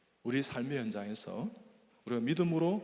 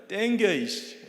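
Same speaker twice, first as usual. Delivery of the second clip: snapping, higher and louder; angry professor; 8 semitones higher, 9 dB louder, much slower than usual. Retold or imitated. Surgeon snapping at assistant in surgery, angry. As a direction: bark it like an irritated surgeon. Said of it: "이러면 화내요".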